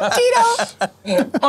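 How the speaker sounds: high comic call of the name; excited. Real, not acted. Dancer shouts Tito's name to get a laugh, high excited.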